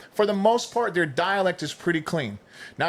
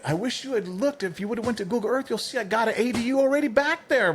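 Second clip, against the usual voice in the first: much higher, playful retort; retold gotcha in a raised pitch; teasing mock regret; 9 semitones higher than usual. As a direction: reveal the catch, teasing the salesman. Retold teasing of cold caller.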